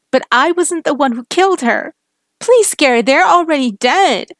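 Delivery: high, loud begging for mercy; desperate pleading. Acted. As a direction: plead with him not to kill you. Woman begging for her life.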